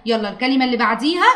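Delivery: usual speaking voice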